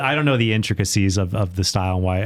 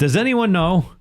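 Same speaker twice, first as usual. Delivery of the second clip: Rabbi Herschel Krustofsky; 10 semitones higher than usual. Retold or imitated. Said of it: "now I'm just Rabbi Herschel Krostowski".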